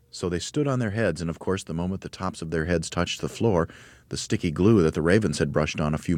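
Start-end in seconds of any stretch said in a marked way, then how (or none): none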